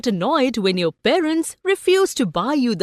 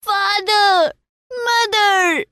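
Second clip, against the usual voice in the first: high, loud desperate shrieks; shrieking; 10 semitones higher, 3 dB louder, slower than usual. Acted. Boy about to be trampled, shrieking for his parents. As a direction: scream the names as loud as you can. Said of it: "he shrieked at the top of his voice".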